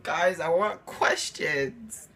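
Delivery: drawn-out, very slow inner plea. Retold or imitated. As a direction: stretch it slowly like a tired request. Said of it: "I'm thinking to myself".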